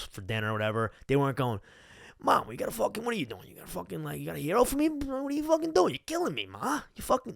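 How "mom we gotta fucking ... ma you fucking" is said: high, hammed-up New York mob accent; Long Island mobster, exaggerated; mock mobster accent. Teen calling his mom about dinner, mock mob accent. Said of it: "speak like I'm in the mob".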